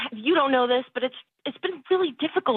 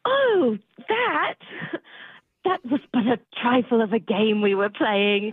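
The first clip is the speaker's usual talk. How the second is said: fake British accent; 4 semitones lower than usual. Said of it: "drop the British thing".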